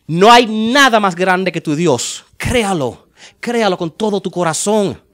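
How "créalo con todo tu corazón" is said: ordinary talk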